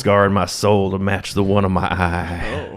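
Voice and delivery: low-voiced mock country balladeer. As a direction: play a dark, poetic country storyteller. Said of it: "sounds exactly like [name]".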